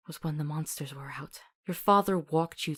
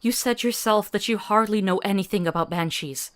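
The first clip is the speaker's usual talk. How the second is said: loud, higher, heated protest; furious grief, faster at the end; angry argument; 3 semitones higher, 9 dB louder than usual. Acted. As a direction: push back hard at him. Arguing with the hunter.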